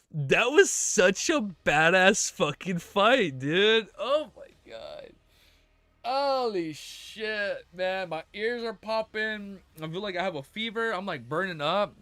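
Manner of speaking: slow, drawn-out, overwhelmed reaction; emotional awe. Real, not acted. Reader overwhelmed by a badass fight, emotional awe. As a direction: stretch the words, overcome by it. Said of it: "I'm getting emotional".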